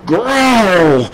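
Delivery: high, loud, straining growl; cat's rumbling growl. A narrator's reading. Cat yanking at the slip knot, growling. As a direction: growl it out with effort. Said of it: "he rumbled".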